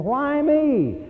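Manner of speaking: high, indignant shout at heaven; mock outraged Viking yelling why me; Hagar's cry. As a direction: shake a fist at the sky and cry it out. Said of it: "and he's saying".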